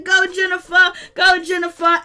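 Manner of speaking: loud, high cheer, repeated like a chant; excited cheering. Retold or imitated. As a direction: egg her on loudly. Retells cheering on Jennifer.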